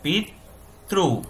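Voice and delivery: पितृ said slowly, every sound drawn out; careful pronunciation. Shown as a demonstration. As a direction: stretch पितृ out slowly as a model. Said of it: "उसको क्या उच्चार होता है".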